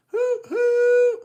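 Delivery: high, loud hoo hoo whistle; train whistle. Shown as a demonstration. Showing kids how Thomas whistles, loud high hoot. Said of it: "Do you see Thomas there going".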